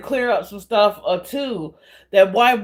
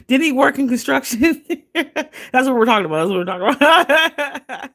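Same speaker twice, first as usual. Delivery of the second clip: high, quick words through giggles; laughing; 6 semitones higher than usual. Real, not acted. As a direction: barely get it out for laughing. Cracking up at innuendo joke.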